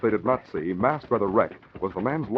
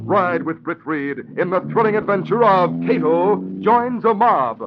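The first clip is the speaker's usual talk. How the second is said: loud, ringing radio-serial announcer over music; booming old-time radio announcer; 7 semitones higher, 4 dB louder than usual. Acted. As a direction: sell the thrilling adventure to the audience.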